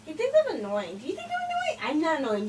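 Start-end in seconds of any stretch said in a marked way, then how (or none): 1.0-1.9 mock whiny question. Pestering the other player.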